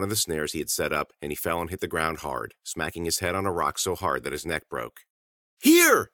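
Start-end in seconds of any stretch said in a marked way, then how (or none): 5.6-6.1 high, loud shout; urgent high yell; excited cry. A narrator's reading. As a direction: call it out to someone far off. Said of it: "he cried".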